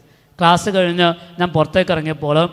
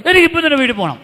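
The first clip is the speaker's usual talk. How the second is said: put-on higher, louder, stubborn girl demanding to leave; stubborn insistence; 9 semitones higher, 6 dB louder, faster than usual. Teen girl demanding the counsellor let her go home, higher and louder. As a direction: push the demand like a stubborn teenager.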